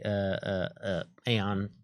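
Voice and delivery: ordinary talk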